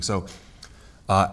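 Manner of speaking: ordinary talk, nothing marked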